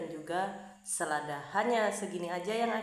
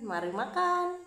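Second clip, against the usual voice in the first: cheery call; 10 semitones higher, 6 dB louder, slower than usual. Real, not acted. Cheery call to start eating.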